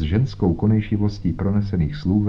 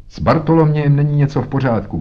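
higher, loud, hurried outcry; alarmed shout; 6 semitones higher, 5 dB louder, faster than usual. Acted. Tadeusz alerts the visitors that something is wrong, alarmed shout. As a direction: cry out fast and high in alarm. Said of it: "křičel".

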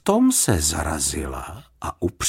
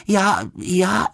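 stammering; 11 semitones higher than usual. A narrator's reading. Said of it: "zakoktal se".

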